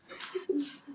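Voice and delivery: sudden high-pitched laugh; high burst of laughter. Real, not acted. Reacts to boiling pasta horns.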